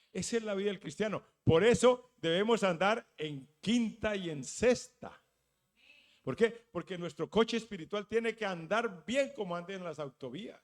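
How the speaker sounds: rally the congregation; rousing preaching. Acted. Preacher urging his church, loud rousing call.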